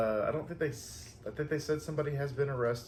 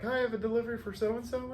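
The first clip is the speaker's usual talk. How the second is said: much higher sing-song hello; sweet delivery greeting; 11 semitones higher, faster than usual. Retold or imitated. Florist to little girl at the door, high and sweet. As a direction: greet a small child at the door.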